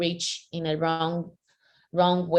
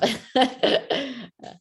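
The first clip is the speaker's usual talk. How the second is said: light, high laugh after an admission; brief high-pitched laugh; short laugh; 7 semitones higher than usual. Real, not acted. Rueful laugh after admitting it's tough.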